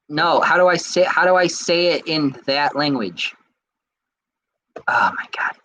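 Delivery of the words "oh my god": whispered under the breath; exasperated whisper. Real, not acted. Streamer to himself as the search fails, exasperated whisper.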